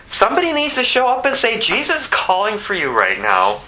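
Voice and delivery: high launch falling into a firm call; urgent preaching. Acted. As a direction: proclaim it like an urgent summons. Preacher urging that someone speak up.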